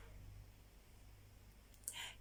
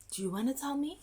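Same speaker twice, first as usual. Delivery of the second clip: ordinary talk, nothing marked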